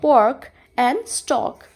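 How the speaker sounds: pork and stalk drawn out very slowly; slow example words. Shown as a demonstration. Teacher modelling r-controlled vowels, very slow. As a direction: lay out each word slowly so students hear it. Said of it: "OR की अगर हम बात करते हैं तो".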